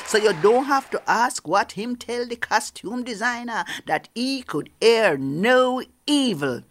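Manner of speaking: usual speaking voice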